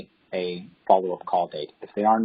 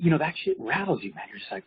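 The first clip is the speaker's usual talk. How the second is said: ordinary delivery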